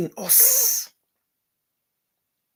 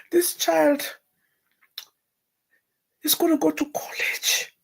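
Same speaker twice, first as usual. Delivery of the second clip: crying out, voice breaking and rising; weeping prayer, voice breaking high; tearful cry to God; 9 semitones higher, 4 dB louder than usual. Retold or imitated. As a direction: plead through tears, then louder. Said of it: "I cried".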